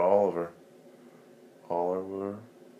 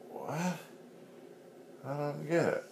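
flustered potato; 6 semitones higher, 4 dB louder, faster than usual. Acted. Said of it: "He's like".

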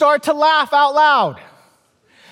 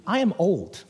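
deadpan admission; 5 semitones lower, 7 dB quieter than usual. Real, not acted. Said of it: "The lesson is this".

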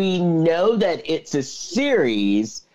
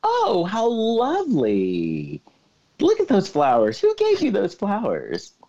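cooing feigned innocence; 6 semitones higher than usual. Acted. Man admiring flowers he planted himself, cooing innocently.

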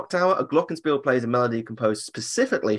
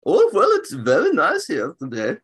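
mock cheerful reply; 6 semitones higher, 4 dB louder than usual. Imagined German cast member replying.

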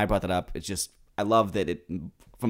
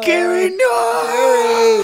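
very high, loud, drawn-out scream; mock horrified scream; 12 dB louder, much slower than usual. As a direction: wail it in fake horror.

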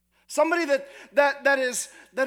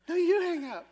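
giggly late night call; 6 semitones higher than usual. Retold or imitated.

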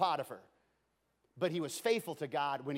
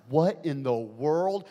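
surprised; 3 semitones lower, 7 dB louder, slower than usual. Acted. Potiphar startled by noise.

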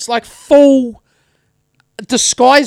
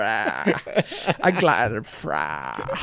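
very slow, much lower mock refusal; jokey drawn-out croak; 12 semitones lower, 9 dB quieter, much slower than usual. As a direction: stretch the words into a long low croak. Repeats the refusal, dragged out jokingly.

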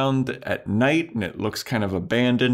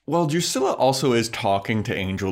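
ordinary delivery

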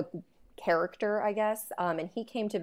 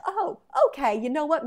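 mock realization; 7 semitones higher, 4 dB louder than usual. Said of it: "I'm like".

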